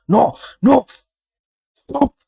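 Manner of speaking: high, loud yelps of alarm; panicked protest. Real, not acted. Streamer begging the troll to stop, high panicked yelps. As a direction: yelp it, pitch shooting right up.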